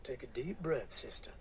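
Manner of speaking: calm her down gently; soothing preacher. Acted. Preacher kneeling beside the woman, soft and tender.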